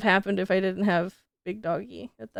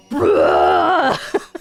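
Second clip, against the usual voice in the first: much lower, loud deep dog voice; 9 dB louder than usual. Retold or imitated. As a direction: voice the dog's unimpressed growl. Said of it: "and she's like".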